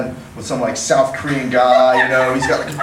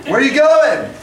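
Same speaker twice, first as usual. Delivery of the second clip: much higher, louder call after someone leaving; laughing mock call-out; 11 semitones higher, 5 dB louder, slower than usual. Guy at the bar calls after the departing woman, loud laughing. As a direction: yell after her through a laugh.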